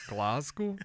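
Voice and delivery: put-on an even worse Scottish accent on Glasgow, said through a laugh; a laughing, botched Scottish Glasgow. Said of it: "That's even worse".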